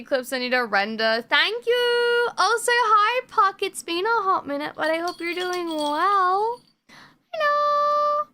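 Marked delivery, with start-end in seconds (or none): 1.3-6.6 bimbo voice. Acted. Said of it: "I'm glad you liked my bimbo voice". 7.3-8.3 high squeaky hello. Acted. Greeting chat in put-on voice.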